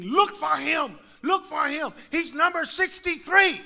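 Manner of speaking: retold scream. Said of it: "he's screaming".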